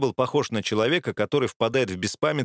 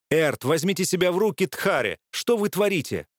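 urgent pleading; 7 semitones higher than usual. Acted. Nuska restrains Erd, pleading.

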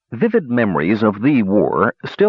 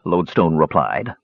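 ordinary delivery, nothing marked

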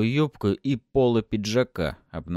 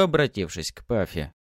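plain reading voice

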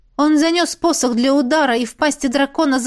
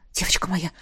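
low, soft endearment; soft and low, comforting her; tender concern; 5 semitones lower, 7 dB quieter than usual. A narrator's reading. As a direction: drop to a gentle, worried voice. Frightened lover kneels to her.

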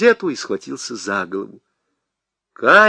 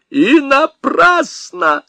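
much higher, louder, slow screech; shriek; 13 semitones higher, 5 dB louder, much slower than usual. A narrator's reading. Husband shrieks at his wife. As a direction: shriek it at her. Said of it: "завизжал он".

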